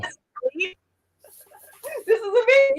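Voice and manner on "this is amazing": laughing mock praise. Real, not acted.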